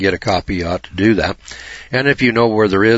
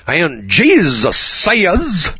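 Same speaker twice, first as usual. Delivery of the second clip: much higher, louder, very slow revival preacher; forceful Billy Graham; 10 semitones higher, 5 dB louder, much slower than usual. Retold or imitated. Mocking Billy Graham's forceful pulpit delivery, high and loud. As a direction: boom it out like a stadium evangelist. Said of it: "Billy Graham, he's a little more forceful".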